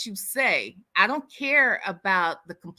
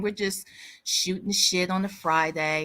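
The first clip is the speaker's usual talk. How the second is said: normal talking voice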